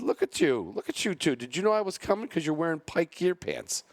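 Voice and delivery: delighted teasing call to fellow judges in matching pants; excited greeting. Retold or imitated. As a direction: tease them brightly, pitch way up. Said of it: "I said".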